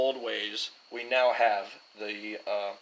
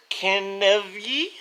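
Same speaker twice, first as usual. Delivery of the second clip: odd Scottish-ish accent; 7 semitones higher, 4 dB louder, much slower than usual. Real, not acted. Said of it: "I don't know why I said it with that accent".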